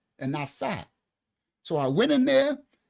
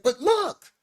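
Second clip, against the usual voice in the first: high, louder put-on plea; pleading; 11 semitones higher, 6 dB louder than usual. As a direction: beg him to look, pitched up. Pleading with doctor.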